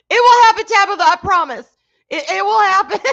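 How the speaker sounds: loud, high, laughing insistence; squealed reassurance dissolving into laughter; excited promise. Real, not acted. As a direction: promise a friend at full pitch, cracking up. Loudly reassures viewer, laughing.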